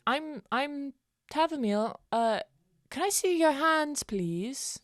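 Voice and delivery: high, slow polite character voice; sweet high introduction. Acted. Tavamil politely asks the men to let them down. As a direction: ask nicely in a high sweet voice.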